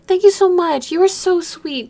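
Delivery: gushing gratitude. Real, not acted. Streamer thanking a viewer for gifts, gushing gratitude.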